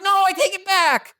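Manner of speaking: high-pitched mock-panicked plea; panicked David. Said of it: "And David's like".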